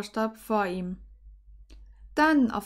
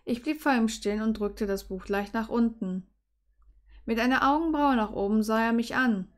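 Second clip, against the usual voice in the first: plain reading voice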